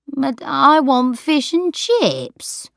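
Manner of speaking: put-on slow, stubborn old-lady demand. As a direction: insist like a stubborn child. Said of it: "demanded Sarah".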